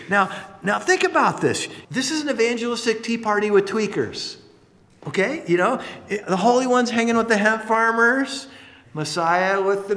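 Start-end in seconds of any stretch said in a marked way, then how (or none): none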